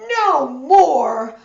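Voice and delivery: loud whining outcry; fed-up cry. A narrator's reading. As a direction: complain loudly like sick sailors. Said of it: "they cry".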